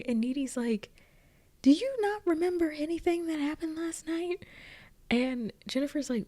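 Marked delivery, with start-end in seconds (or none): 1.6-4.4 high-pitched, incredulous question; mock disbelief. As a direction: imitate a friend who can't believe it. Acted baffled friend confronts oblivious girl.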